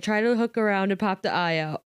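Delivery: ordinary delivery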